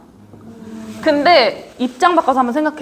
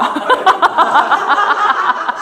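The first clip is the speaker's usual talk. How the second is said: long rippling laugh; sustained giggling laughter; 4 semitones higher than usual. Real, not acted. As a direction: burst out laughing. Banter about the story.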